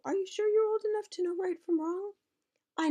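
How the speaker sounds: mother's voice. Acted. Mother questioning pot.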